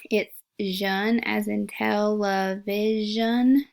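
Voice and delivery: slow teacher model, zhun drawn out as in television; teaching a sound. Shown as a demonstration. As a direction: slowly sound out zhun for children. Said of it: "here's a new sound".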